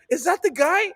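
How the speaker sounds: very high, loud incredulous question; retold high-pitched shock at spotting someone; amused disbelief. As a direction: squeal it in laughing astonishment. Incredulous retold question amid laughter.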